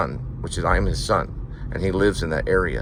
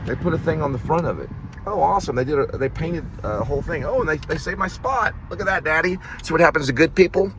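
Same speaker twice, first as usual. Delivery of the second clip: 1 excited; 8 semitones higher than usual. Real, not acted. Excited at the storefront.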